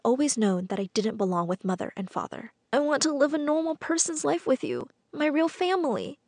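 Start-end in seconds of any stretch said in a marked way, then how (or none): 2.7-6.2 high-pitched pleading moan; moaning plea. A narrator's reading. Runaway pleading with birth parents to take them in, moaning. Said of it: "I moaned".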